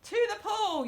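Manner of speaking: high, loud yell; urgent yell. A narrator's reading. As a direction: shout it out high. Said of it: "yelled the fireman".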